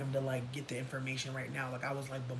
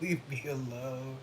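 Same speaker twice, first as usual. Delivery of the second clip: weary brush-off; 2 semitones higher, 5 dB louder, slower than usual. Retold or imitated. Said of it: "I was like".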